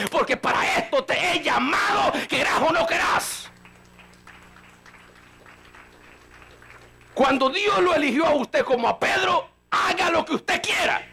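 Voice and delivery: mucho más agudo y a gritos, predicando con fuerza; predicación encendida. Acted. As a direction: reprende a la congregación a todo pulmón. Preacher peaks voicing God. (predicador que clama con fuerza a su iglesia)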